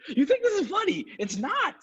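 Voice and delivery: squeaky mock outrage; mock indignant, high. Real, not acted. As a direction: pretend offended at the laugh. Scolding co-host for laughing.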